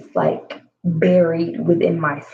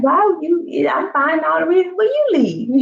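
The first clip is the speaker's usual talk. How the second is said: high, incredulous retort; mock exasperated; 10 semitones higher than usual. Telling him off in a high incredulous voice. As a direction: pitch it way up in disbelief.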